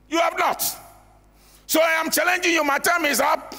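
high, forceful challenge from the pulpit; impassioned sermon challenge; preaching. Acted.

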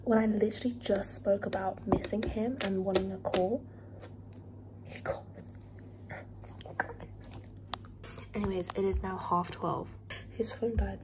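whispering. Real, not acted. Late-night whispered talk.

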